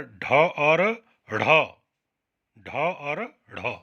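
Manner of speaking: slow, clear ढ against ढ़; patient teacher spelling sounds slowly; slow contrast demo. Shown as a demonstration. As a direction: draw out each letter so the kids hear the difference. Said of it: "इसका उच्चारण ठीक से आपको आ जाना चाहिए".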